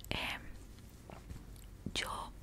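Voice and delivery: ordinary talk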